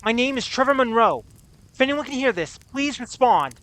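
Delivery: loud hopeful hail into the radio; calling out. Acted. Stranded mechanic calling out over a radio, loud and hopeful. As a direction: raise the voice to reach anyone listening.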